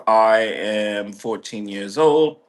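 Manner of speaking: ordinary talk, nothing marked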